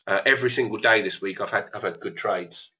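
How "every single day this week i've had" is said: ordinary delivery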